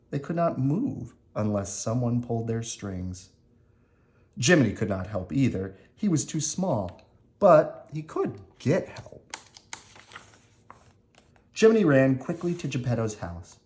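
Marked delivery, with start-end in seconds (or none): none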